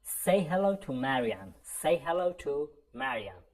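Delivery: slow, clearly spaced English for learners; slow model sentence. Shown as a demonstration. Teacher modelling a phrase for students, slow and clear. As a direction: say each word slowly so students can repeat. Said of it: "بگید به مریم سلام برسون".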